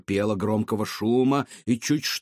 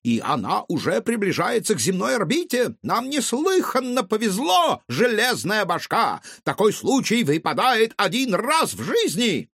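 high, ecstatic mad scientist yelling; raving with manic delight; 10 semitones higher than usual. A narrator's reading. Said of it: "вопил он".

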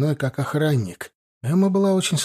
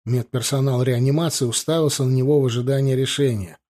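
usual speaking voice

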